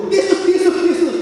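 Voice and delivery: high yelping cry of Yesus on the swaying gondola; frightened cry. Retold or imitated. Wife calls on Yesus in fear as the gondola sways, squealing high. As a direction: squeal the name in mock panic. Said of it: "Udah ketakutan".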